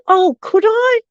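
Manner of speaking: high, rising, pleased question; retold eager question, pitched well up; delighted asking. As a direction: imitate someone delighted by an invitation. Acting out judge's delighted question.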